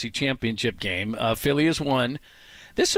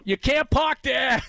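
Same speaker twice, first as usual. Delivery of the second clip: high, slow Boston shout; Boston accent yell; 12 semitones higher, slower than usual. Retold or imitated. Host retelling the Boston guy, Boston accent yell. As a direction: call out like a Boston driver. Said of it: "Boston guy retold".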